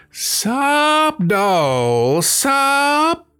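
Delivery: piercingly high, loud yowled chant; cat's holler. A narrator's reading. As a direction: wail each word out long and loud. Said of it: "licked it and hollered".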